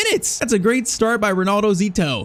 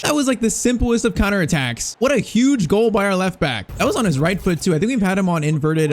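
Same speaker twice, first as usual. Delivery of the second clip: usual speaking voice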